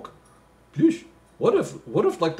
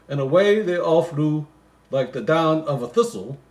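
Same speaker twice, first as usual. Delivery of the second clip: reads the Christmas poem aloud, a bit louder; poem reading; 4 semitones higher, 7 dB louder than usual. Acted. Comic reader reciting the Christmas poem, lightly sing-song. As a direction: recite the verse with a touch of storybook lilt.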